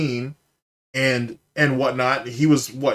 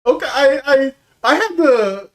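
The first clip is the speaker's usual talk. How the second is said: high, loud voice recovering from hard laughter; real laughing fit; 11 semitones higher, 6 dB louder than usual. Real, not acted. Streamer reacting to a viewer's joke, laughing hard. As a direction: squeal it out, still cracking up. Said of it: "I'm still laughing at the first one".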